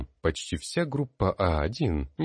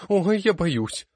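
high, louder and slow frightened wail; 13 semitones higher, 6 dB louder, slower than usual. A narrator's reading. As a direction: whimper out the fear. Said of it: "запричитала девушка". (испуганно причитая, высоко и медленно)

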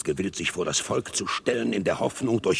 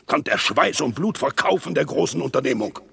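normal talking voice

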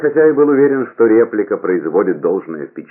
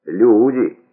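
louder, higher, drawn-out peasant punchline; sly, drawling peasant; 5 semitones higher, 5 dB louder than usual. A narrator's reading. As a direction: draw it out slyly as a stage peasant. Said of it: "в лукавом и медлительном тоне крестьянина". (лукаво и медлительно, по-крестьянски)

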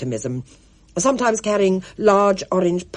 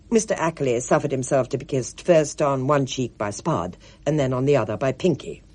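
usual speaking voice

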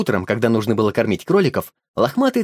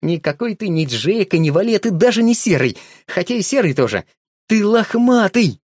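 joyful discovery; 6 semitones higher than usual. A narrator's reading. Boy finally guesses the dog's name, joyful. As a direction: build up to the name with joy.

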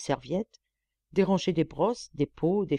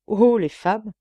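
chirpy, louder playful exclamation; twittering; 5 semitones higher, 9 dB louder, slower than usual. A narrator's reading. Monsieur teasing his wife, chirpy and playful. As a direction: twitter it like a flirt. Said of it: "gazouilla monsieur".

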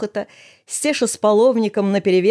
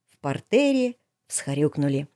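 ordinary delivery

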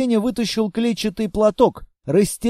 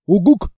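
short loud rising hoot; owl-like hoot; 5 dB louder than usual. Acted. Monster hoots a loud reply to the girl. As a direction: hoot like a big friendly monster.

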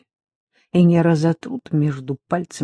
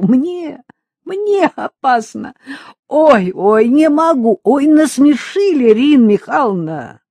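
высоко, сквозь смех, протяжно; заливайся, еле выговаривая слова; бесшабашный хохот; 9 semitones higher, 6 dB louder, slower than usual. A narrator's reading. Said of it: "захохотала. Бесшабашно весело". (весёлый визгливый хохот, всё громче)

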